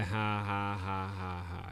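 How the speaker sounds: slow, deliberate fake ha-ha; mock laugh. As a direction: laugh it out word by word, badly faked. Fake laugh at a weak joke.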